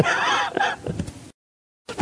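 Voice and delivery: laughing. Real, not acted. Laughs at the persistent girl.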